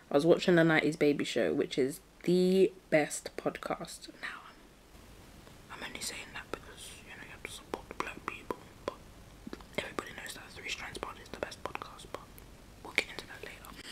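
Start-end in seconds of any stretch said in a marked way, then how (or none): 5.7-13.8 very soft, fast whisper; whispered aside. Pretend. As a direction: confide it quickly under your breath. Confides an aside to viewers.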